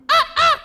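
very high, loud sung yelp. Retold or imitated. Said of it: "intercala un grito agudo".

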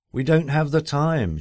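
impatient wife voice. Retold or imitated.

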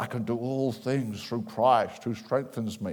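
slow, low, hammed-up platform voice; mock a preacher booming the verse from the stage, drawn out; deep, grandiose parody of a platform speaker; charismatic platform preacher. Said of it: "It's like sort of charismatic platform".

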